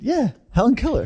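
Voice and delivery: laughing through the words; cracking up at her own joke. Real, not acted.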